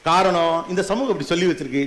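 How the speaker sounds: ordinary talk, nothing marked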